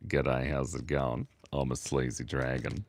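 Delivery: low, slow, lounge-lizard greeting; sleazy dragon voice. Acted. Streamer voices the sleazy dragon he just drew, low and slow. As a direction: play the creepy dragon oozing charm, low and slow. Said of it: "And it's like".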